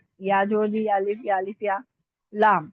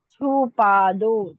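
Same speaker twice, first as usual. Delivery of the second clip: slow dictation; 5 dB louder, much slower than usual. Shown as a demonstration. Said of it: "छ को हमने भारी हा जोड़ दिया".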